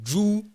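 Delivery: "Zhu" said high and loud, clearly modelled; Mandarin syllable. Shown as a demonstration. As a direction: model "Zhu" for the class to repeat. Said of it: "Tu peux les prononcer en même temps que moi".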